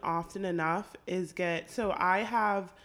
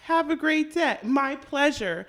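overly polite cashier voice, higher; Chick-fil-A cashier; 5 semitones higher, 4 dB louder than usual. Pretend. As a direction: chirp it like a fast-food worker. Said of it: "a spot on".

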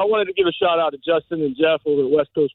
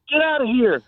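retold yell, pitched up; mock kids' yelling; 8 semitones higher than usual. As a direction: imitate kids yelling across the water. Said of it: "start yelling".

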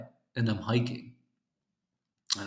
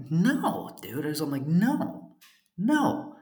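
firm refusal; 5 semitones higher, slower than usual. Retold or imitated. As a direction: shut him down hard. Refusing the stranger's advances.

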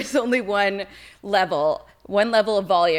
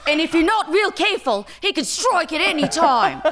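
high, thrilled Australian wildlife-host impression; Steve Irwin; 9 semitones higher than usual. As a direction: warn of danger with Australian showman's relish. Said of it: "Steve Irwin".

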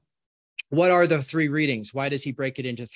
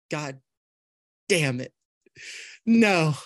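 salty, a little sad; slower than usual. Retold or imitated. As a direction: grumble it in pieces with long pauses, lightly acted. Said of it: "feeling a little salty, a little sad".

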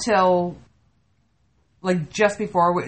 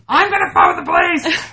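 retold scream; 3 semitones higher, 4 dB louder than usual.

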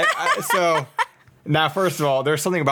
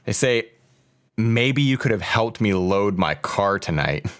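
slow, low post-reading voice; missed-connection read; 5 semitones lower than usual. Acted. Podcaster reads a Craigslist love note to Morgan, slow earnest read.

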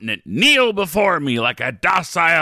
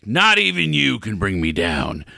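usual speaking voice

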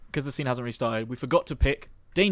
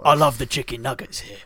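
Jason Statham; 3 dB louder than usual. Acted. Voices Jason Statham at the restaurant.

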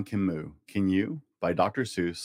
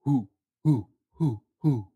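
a higher owl hoo; owl hoot; 4 semitones higher than usual. Acted. Dad reading to a child, owl hoots. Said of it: "He can go like an owl".

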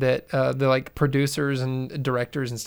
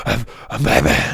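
ruined gravelly Batman voice; 4 semitones lower than usual. Retold or imitated. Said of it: "becomes gravelly and awful".

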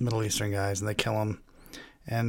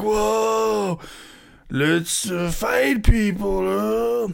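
high, slow dopey surfer drawl; Keanu Reeves impression; 7 semitones higher, much slower than usual. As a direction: stretch it out like a stoned surfer dude. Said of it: "a good Keanu Reeves impersonation".

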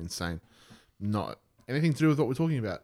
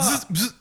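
high buzzing alien noise; 11 semitones higher than usual. Retold or imitated. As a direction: buzz like a little alien. Alien noise in retold story.

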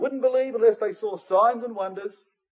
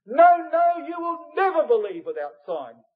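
high loud burst on no, no, then stress on never; very emphatic; 10 semitones higher, 4 dB louder than usual. Retold or imitated. Preacher voicing Jesus's emphatic Greek to the crowd, loud high burst. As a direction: cry out the denial with full emphasis. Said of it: "the Greek is very emphatic here".

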